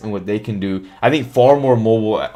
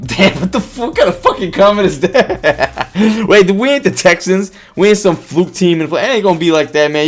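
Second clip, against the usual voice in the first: laughing indignation; 6 semitones higher, 4 dB louder than usual. Real, not acted. Reacting to viewer's blowout comment.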